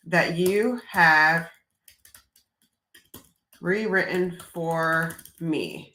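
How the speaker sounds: slow, spelled-out prompt; slow, careful dictation of the rest of the prompt. Shown as a demonstration. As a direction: dictate each word to the machine.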